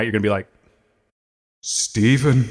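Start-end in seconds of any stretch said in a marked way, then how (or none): 1.6-2.5 ghost with vocal effect. Pretend. Podcaster as fallen ghost calls his friend at night, spooky echoing voice. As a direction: ghostly call through an echo effect. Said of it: "sick of this vocal effect today".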